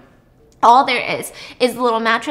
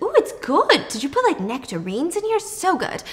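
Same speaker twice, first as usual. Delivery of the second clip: delighted; 6 semitones higher than usual. Retold or imitated. Noah tasting the drink.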